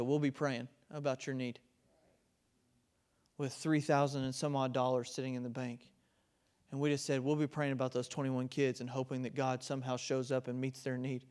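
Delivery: mock hollow promise. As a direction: hush it like a lame excuse. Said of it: "What if we'd have told Project Santa".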